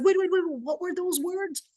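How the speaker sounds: retold frantic interruption, very high-pitched; urgent excitement. Wife stops husband to catch the dish names, urgent excitement. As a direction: jump in urgently to catch the words. Wife seizes on long-awaited words.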